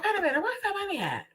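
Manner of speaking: high, fast, breathless panic; sudden panic, high and quick; panicked. Retold or imitated. Retelling finding the money gone, high panic.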